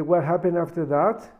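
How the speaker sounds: ordinary delivery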